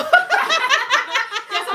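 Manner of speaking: high, loud burst of laughter; big laugh. Real, not acted. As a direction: crack up loudly. Risa por el comentario leído. (carcajada aguda y fuerte)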